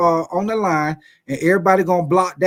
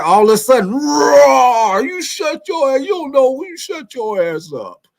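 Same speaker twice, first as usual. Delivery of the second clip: mock Hulk roar, higher and louder; hammed-up monster rampage, roaring and crying out; Incredible Hulk rage; 8 semitones higher, 4 dB louder than usual. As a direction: play the raging Hulk going off on someone. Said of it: "get on like Incredible Hulk on your ass".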